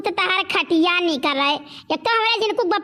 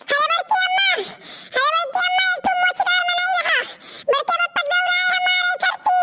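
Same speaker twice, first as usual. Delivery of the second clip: put-on panicked child; 10 semitones higher than usual. Child urgently calling aunt.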